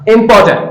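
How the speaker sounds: इंपॉर्टेंट as im-PAW-tnt, R dropped; correct pronunciation. Shown as a demonstration. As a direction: firmly model इंपॉर्टेंट with a light, reduced ending. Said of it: "that is incorrect pronunciation".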